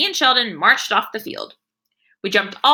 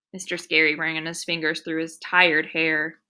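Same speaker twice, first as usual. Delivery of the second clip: ordinary delivery, nothing marked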